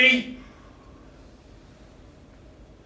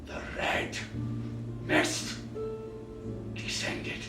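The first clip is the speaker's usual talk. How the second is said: menacing whisper; 3 semitones lower, 6 dB quieter than usual. Acted. Man gripping bottle as rage rises, menacing whisper. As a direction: hiss it as fury rises.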